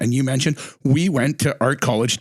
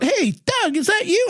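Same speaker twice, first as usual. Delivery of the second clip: much higher, called-out greeting to a passing friend; calling out. Retold or imitated. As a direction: hail him from across the street. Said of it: "he yelled out".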